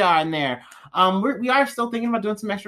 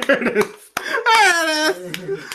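laughing; 11 semitones higher, slower than usual. Real, not acted. Laughing approval.

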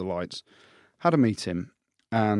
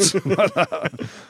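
laughing; 8 semitones higher than usual. Real, not acted. Laughs at his own story.

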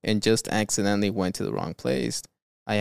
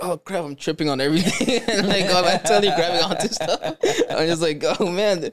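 higher, laughing through mock exasperation; laughing retelling; 7 semitones higher than usual. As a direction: act out clumsy stumbling while cracking up. Retelling his clumsy homecomings, laughing.

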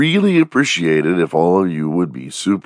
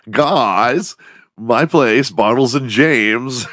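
put-on party voice; 6 semitones higher, much slower than usual. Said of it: "then you go".

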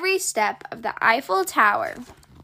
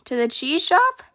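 a hopeful little question, pitched up; 4 semitones higher than usual. A narrator's reading. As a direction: ask it wishing hard for a yes. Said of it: "Puddle asked hopefully".

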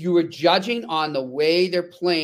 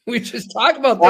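laughing while talking, pitch jumping higher; 8 semitones higher, 5 dB louder than usual. Real, not acted. Host laughing at a listener's question, amused and louder. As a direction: laugh through it, amused by the coincidence.

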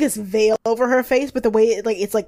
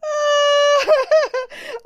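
high helpless laugh; 5 dB louder than usual. Real, not acted. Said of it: "laughing at boy bands".

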